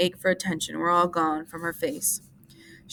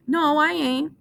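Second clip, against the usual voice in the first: earnest; 10 semitones higher, 5 dB louder, slower than usual. A narrator's reading. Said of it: "Lenny explained earnestly".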